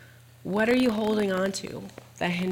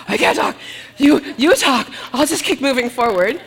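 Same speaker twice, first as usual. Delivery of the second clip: breathless, louder, pushing the words out mid-run; out of breath; 5 semitones higher, 9 dB louder than usual. Retold or imitated. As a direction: gasp it out like you can barely talk while running. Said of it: "I was like".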